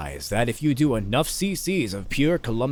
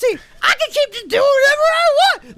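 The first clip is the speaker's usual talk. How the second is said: very high, loud screeching character voice; Doctor Roxo-like Twilight Sparkle; 7 dB louder than usual. Acted. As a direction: screech like a manic cartoon performer greeting kids. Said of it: "why did Twilight Sparkle sound like Doctor Roxo".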